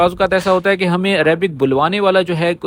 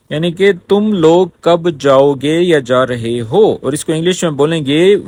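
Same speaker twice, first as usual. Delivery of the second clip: usual speaking voice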